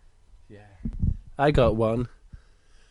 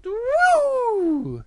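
very high, loud, drawn-out woo; sliding whoop; 5 dB louder than usual. Acted. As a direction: whoop all the way down a slide. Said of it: "And he goes".

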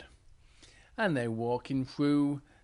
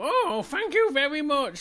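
high, louder storybook gratitude; delighted thanks; 11 semitones higher, 5 dB louder than usual. Acted. Bears thank the farmer for the apples, delighted. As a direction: thank with bouncy acted delight.

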